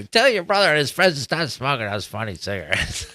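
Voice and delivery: a hint of a nagging mom; imitating mom. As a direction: lightly play the fussing mother. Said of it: "my mom would always fucking say".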